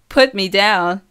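loud demand to be released; loud, insistent plea; protesting shout. A narrator's reading. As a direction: call it out in dizzy protest. Repeated protest while being spun.

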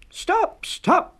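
very high, loud call to stop; high loud cry of stop. A narrator's reading. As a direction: people shouting after a runaway. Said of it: "cried the people".

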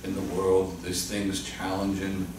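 soft, hushed prayer voice; closing prayer. Real, not acted. Pastor closing the service in prayer, hushed and reverent. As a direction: pray quietly before the congregation.

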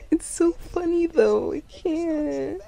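laughing. Real, not acted. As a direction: laugh helplessly while talking, drawn out. Can't stop laughing at him.

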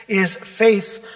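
ordinary talk

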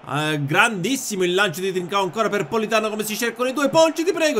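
climbing, urgent play-by-play; breathless italian goal commentary. Real, not acted. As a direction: build the attack toward a shout. Live match commentary. (telecronaca che sale di tensione)

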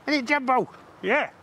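high, bright call to a dog. Real, not acted. Vlogger calls out happily to his dog Jumbo. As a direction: sing out to the dog.

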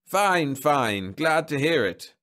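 high, hearty and drawn-out approval; beaming. A narrator's reading. Counsellor pleased at a husband's bad news, beaming. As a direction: beam as you say it, stretching the words. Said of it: "The man from Marriage Relations beamed".

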